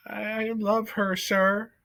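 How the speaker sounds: put-on young mouse confessing his love to the judge; earnest young Despero. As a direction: say it plainly from the heart.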